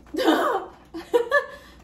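loud, high burst of laughter; surprised laugh. Real, not acted. Unboxer surprised by what is in the box, laughing loudly.

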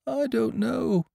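high, slow, drawn-out lament; rather doleful. A narrator's reading. Pooh answering Piglet's question, doleful high voice. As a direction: draw it out mournfully in a high voice. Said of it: "said Pooh rather dolefully".

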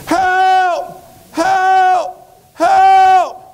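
high, loud, long-held cries; screaming for help. Acted. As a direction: yell desperately to be rescued. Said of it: "still down there screaming".

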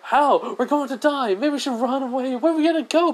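high-pitched mock panic; hammed-up, squealed alarm; freaking out. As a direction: play a frightened crowd, voice shooting way up. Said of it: "Everyone kinda freaking out".

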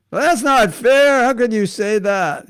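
loud, high-pitched mock outrage; indignant protest. Devotee objecting to the astrologer, loud indignant protest. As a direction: wail it like an offended whiner.

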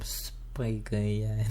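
soft, slow, breathy-free murmur of a title; quiet slow. Pretend. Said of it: "videonun adını buldum".

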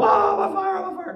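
high, loud mock panic cry. Preacher mocks panicking over a small spark, high hammed-up panic. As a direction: ham up a panic as if your clothes caught fire. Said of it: "you don't go".

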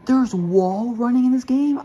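surprised. Real, not acted. Discovering wall running.

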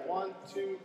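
ordinary talk

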